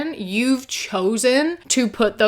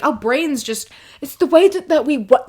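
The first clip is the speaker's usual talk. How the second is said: mocking the husband's excuse; 5 semitones higher than usual. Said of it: "It's wild to me that you're like".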